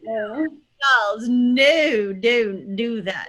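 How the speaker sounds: Queen's English, drawn-out smiling O; super-posh British. Shown as a demonstration. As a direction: scold in a hammed-up posh British accent. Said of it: "That's super posh".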